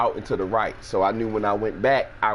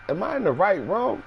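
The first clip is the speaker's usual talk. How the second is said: much higher, doubtful voice; unsure; 10 semitones higher than usual. Retold or imitated. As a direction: ask it high and lost. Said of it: "I'm looking like".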